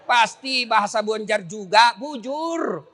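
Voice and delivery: usual speaking voice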